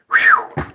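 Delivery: high whistled whew; loud, high exhale after a mouthful; whew of relief. Real, not acted. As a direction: let out a sharp, high "whew". Relief after a long phrase.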